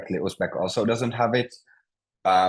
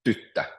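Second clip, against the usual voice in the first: TYT-tö, stressed at the start; first-syllable stress. Shown as a demonstration. Teacher repeats word for girl, stress on first syllable. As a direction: put the weight on TYT in tyttö. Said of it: "stress is always on the first syllable".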